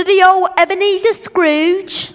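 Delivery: loud mock old-English accent; hammed-up Dickensian accent; put-on Scrooge accent. Boy names the grumpy neighbor to his parents, mock accent. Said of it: "Nice accent".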